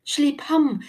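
high, loud cry of alarm; desperate yell. A narrator's reading. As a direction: yell it out as an urgent order. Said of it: "hylte Thelma". (desperat hyl)

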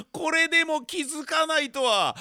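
ordinary delivery

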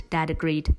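plain reading voice

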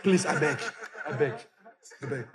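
soft, pleading beg; mock pleading. Sufferer begging God at night, soft plea.

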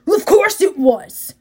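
defensive denial. A narrator's reading. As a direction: bristle and deny it. Ares angrily denies accusation.

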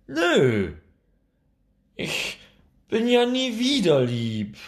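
put-on high, loud defiant child scream. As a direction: yell it like a stubborn little girl. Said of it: "schreit Nora".

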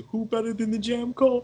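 loud excited shout. Real, not acted. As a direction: belt out the theme name. Singing out the theme song title.

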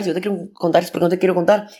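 words tumbling out fast, a bit worked up; rushed, fast. Retold or imitated. Refusing nosy classmates, talking fast. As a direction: rattle it off fast like you're getting carried away. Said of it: "empecé a hablar muy rápido".

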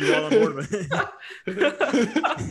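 high-pitched, laughing tease; real laughter breaking through the words. Real, not acted. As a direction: tease him while cracking up. Teasing co-host, breaking into laughter.

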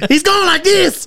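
high, slow put-on voice; drawn-out, high-pitched teasing imitation; mock friend's voice. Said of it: "No, it's more like".

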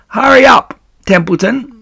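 rat's high, loud mimicry of a plea; Wilbur, mocked. A narrator's reading. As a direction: mimic a frantic urging, sneering. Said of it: "He began imitating Wilbur's voice".

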